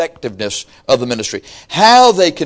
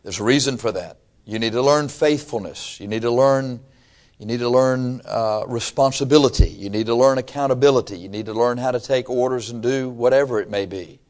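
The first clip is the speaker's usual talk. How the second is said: ordinary talk, nothing marked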